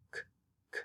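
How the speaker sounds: k, k said as a bare puff of breath; voiceless k sound. Shown as a demonstration. Teacher shows the voiceless k to students. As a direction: hiss out the k with no voice at all. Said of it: "doesn't have a sound".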